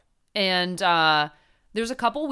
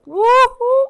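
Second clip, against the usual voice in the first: loud, high whoop; excited cheer; 13 dB louder than usual. Real, not acted. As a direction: cheer at the top of your lungs. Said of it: "Just overmodulated".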